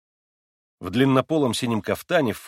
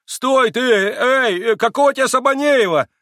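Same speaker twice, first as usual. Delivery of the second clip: much higher, loud flustered shouting; calling after him; 12 semitones higher, 8 dB louder than usual. Acted. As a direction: yell after the departing boy. Flustered fellow calling after Kolya.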